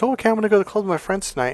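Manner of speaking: high, quick, airy-headed imitation; mock girlfriend. Mocks girlfriend leaving for club.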